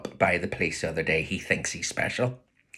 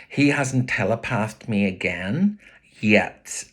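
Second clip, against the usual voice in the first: ordinary delivery, nothing marked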